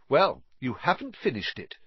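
triumphant. A narrator's reading. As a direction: throw your win in his face. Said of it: "said Harry triumphantly".

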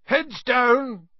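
high, loud warning yell. A narrator's reading. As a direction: yell a warning to all the boats. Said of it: "yelled Hagrid".

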